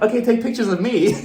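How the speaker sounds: high-pitched, eager interjection; mock attention-seeking. Mother pushing her son aside for the camera, mock eager. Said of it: "struggling with the urge of getting in front".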